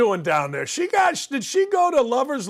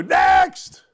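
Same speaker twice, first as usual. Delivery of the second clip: loud high shout of next; calling out; 10 semitones higher, 5 dB louder than usual. Acted. Host moving on to the next topic, loud call. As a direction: bark it out like a game show host.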